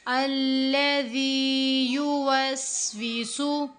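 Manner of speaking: slow melodic tilawat; slow recitation. Shown as a demonstration. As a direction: recite the phrase drawn out and sung. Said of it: "teacher recites the verse". (धीमी तिलावत)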